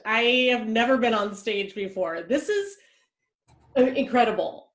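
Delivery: ordinary delivery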